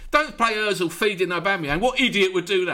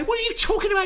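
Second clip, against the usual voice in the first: much higher, shouted disbelief; exasperated outburst; 12 semitones higher, much faster than usual. Real, not acted. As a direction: yell it in real frustration. Exasperated rant about Ozil benched.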